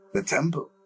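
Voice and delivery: with conviction. A narrator's reading. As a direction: declare it as settled fact. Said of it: "he spoke with conviction".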